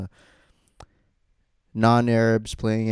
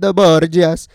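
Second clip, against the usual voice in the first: mock Italian accent; 8 semitones higher, 10 dB louder than usual. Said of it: "that's actually a line".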